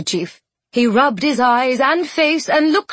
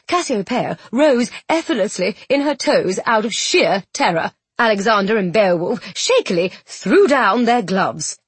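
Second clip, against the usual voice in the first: ordinary delivery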